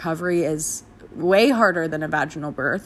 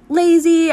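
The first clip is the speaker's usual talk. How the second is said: "lazy" pitched high and loud; stress on lazy; 10 semitones higher, 8 dB louder than usual. Real, not acted. Mother confesses old view of C-section mamas, loud high "lazy". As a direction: punch "lazy" out high and drawn out. Owning her old blunt judgement.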